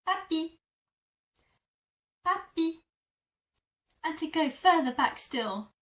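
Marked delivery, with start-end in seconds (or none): 0.1-0.6 Papi said high and affectionate; endearing Papi; cute pet name. Shown as a demonstration. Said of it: "they would say". 2.2-2.8 Papi said high and affectionate; endearing Papi; cute pet name. Shown as a demonstration. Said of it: "they would say".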